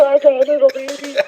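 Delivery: put-on very high cooing baby voice; mock baby talk. Mocking how investigators address child spirits, high baby talk. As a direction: gush absurdly high, as at a baby. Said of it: "talks to their five-year-old kid like that".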